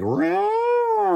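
high, wailing mock siren; imitated siren roar. Comedian imitating the Dubai prayer siren, high wailing roar. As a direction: howl like a distant alarm. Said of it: "all of a sudden I hear, like".

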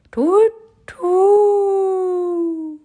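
high, loud, long hoot; owl hoot. Acted. Owl in the bushes startling Albie, loud high hoot.

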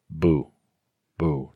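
"boo" held on one flat pitch; level tone demo. Shown as a demonstration. Showing friends the straight Mandarin tone on "boo". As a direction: keep "boo" level, no rise or fall. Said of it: "you can just go straight".

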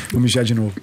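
ordinary talk

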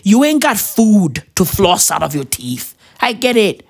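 ordinary talk

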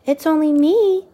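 put-on high, loud little girl voice; little princess. A narrator's reading. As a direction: chirp it up bravely at the giant. Sophie answering the giant.

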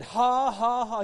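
deliberate put-on ha-ha-ha; forced laugh. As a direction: imitate someone making himself laugh. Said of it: "He said he just put it on".

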